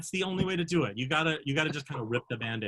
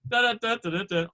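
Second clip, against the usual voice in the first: quick run of higher, louder "da"s; imitated scurrying noise; 7 semitones higher, 5 dB louder than usual. Mimicking an ensemble member hustling her off stage, comic "da-da" noises. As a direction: rattle off nonsense syllables to mime a grab. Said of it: "just kind of like".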